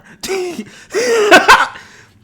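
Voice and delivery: loud high laugh. Real, not acted. Bursts out laughing.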